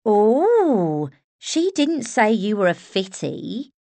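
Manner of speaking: put-on Estuary accent, flirty and bold; broad Estuary accent. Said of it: "with a broad Estuary accent".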